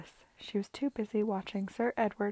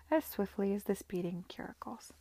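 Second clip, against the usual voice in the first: usual speaking voice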